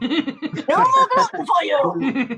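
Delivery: mock German doctor verdict, slow; German accent. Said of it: "He then says".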